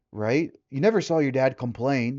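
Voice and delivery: usual speaking voice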